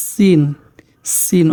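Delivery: সিন opened with a long thin s; সিন as "sssin"; sizzling S. Shown as a demonstration. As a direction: demonstrate সিন with the s held like a sizzle. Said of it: "করে একটা আওয়াজ হয়".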